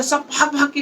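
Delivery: ordinary delivery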